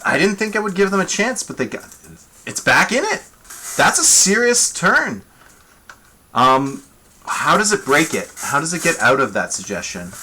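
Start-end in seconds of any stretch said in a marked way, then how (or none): none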